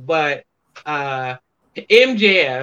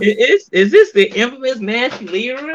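raised, excited question; retold amazement; 8 semitones higher, 5 dB louder than usual. Guest imitates Isaiah recognizing him, raised and excited. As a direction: sound thrilled to meet him. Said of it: "Isaiah said".

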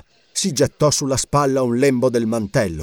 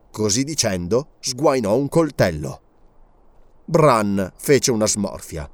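plain reading voice

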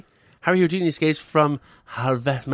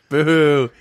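ordinary talk

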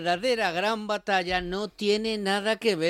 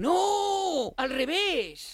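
indignant no; 10 semitones higher, much slower than usual. Real, not acted. Correcting Alicia indignantly.

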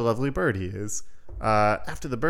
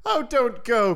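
high, louder pleading cry; frightened cry; 13 semitones higher, 5 dB louder, slower than usual. Acted. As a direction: beg him not to go, scared. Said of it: "cried the wife".

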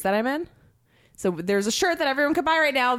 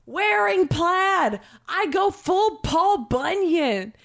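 proud boast; 8 semitones higher, much slower than usual. Real, not acted.

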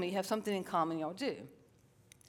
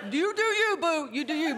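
sassy; 8 semitones higher, 5 dB louder than usual. Acted. Sassy aside to the audience.

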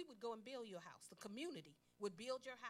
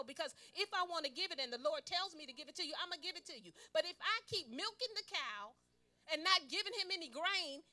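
loud, high, fervent preaching; preaching at full force; 6 semitones higher, 10 dB louder than usual. Acted. Preacher urges the congregation to say no. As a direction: rouse the church to agree.

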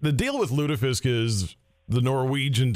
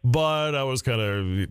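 normal talking voice

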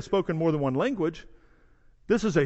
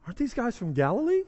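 puzzled question; 3 semitones higher, 4 dB quieter, faster than usual. Acted. Acting out puzzled onlookers.

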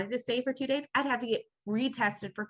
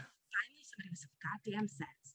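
much softer relieved aside; muttered relief; 2 semitones lower, 15 dB quieter than usual. Retold or imitated. Relieved at the helpful agent, soft muttered aside. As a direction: breathe it out under your breath.

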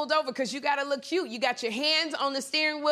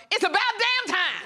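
[name] catchphrase; 8 semitones higher than usual. Retold or imitated. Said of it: "borrow a phrase from my girl [name]".

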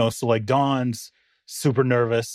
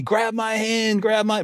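retold scream; 7 semitones higher, slower than usual. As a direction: imitate the guide calling out in a hurry. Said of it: "he's screaming at me".

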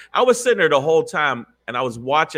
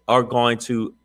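normal talking voice

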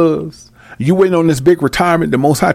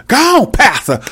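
high call of encouragement, hammed up; mock cheering congregant; 7 semitones higher than usual. Congregant cheering on a dancing pastor, mock excitement. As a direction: egg the preacher on in parody.

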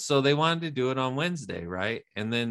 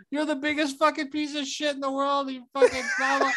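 mock insulting rant; 12 semitones higher than usual. Quoting insulting message.

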